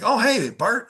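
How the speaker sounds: mock customer's keen recognition; pleased recognition. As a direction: greet the caller as if you suddenly know him. Acted customer recognising the caller.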